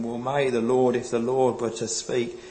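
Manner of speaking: ordinary talk, nothing marked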